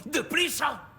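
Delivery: high, frantic urging; urgent hurrying. Acted. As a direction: rush the listener on desperately. Urging her on as time runs out.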